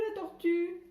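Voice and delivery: put-on "tortue" sung out high and loud to the children. Shown as a demonstration. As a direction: bring the word "tortue" up and out. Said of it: "comme dans Juju". (« tortue » lancé fort et aigu)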